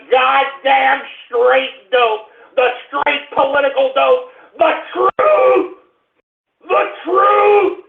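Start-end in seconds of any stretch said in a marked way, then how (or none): 4.6-7.8 much higher, ringing cry of the truth; shouting the truth. Real, not acted. Host crying out that he tells listeners the truth, shouting. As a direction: proclaim it like a battle cry. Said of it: "it just gets me angry".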